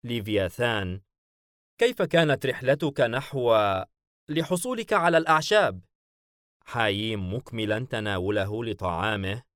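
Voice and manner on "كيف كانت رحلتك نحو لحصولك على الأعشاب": higher, louder dialogue voice; animated question across the table; lightly voiced character. A narrator's reading. Leviathan asking his guest about the herb trip, lightly voiced.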